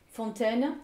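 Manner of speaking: ordinary delivery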